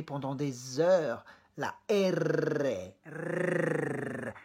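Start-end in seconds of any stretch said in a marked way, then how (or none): 1.6-4.3 R trilled, tongue bouncing on the palate; strongly rolled Spanish R. Shown as a demonstration. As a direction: roll the R several times. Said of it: "Elle rebondit plusieurs fois".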